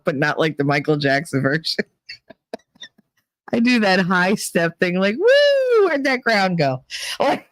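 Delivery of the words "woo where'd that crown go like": put-on drunken whoop. Drunk moonwalk, acted out.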